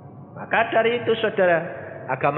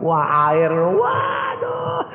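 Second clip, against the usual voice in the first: mock distress; 7 semitones higher, much slower than usual. Acting out kneaded dough's distress.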